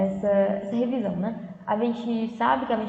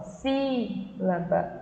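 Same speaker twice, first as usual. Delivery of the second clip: sílaba very slow, SÍ held long; slow syllable-by-syllable sílaba; stressed antepenultimate syllable; 3 semitones lower, much slower than usual. Shown as a demonstration. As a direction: stretch SÍ, then let la-ba fall away. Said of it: "E se for a antepenúltima".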